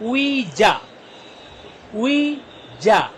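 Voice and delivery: long drawn-out "उई", then separate "जा"; syllable by syllable. Shown as a demonstration. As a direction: stretch "उई जा" slowly for learners. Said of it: "दो syllable से ये भी". ("उई जा" को खींचकर, अलग-अलग)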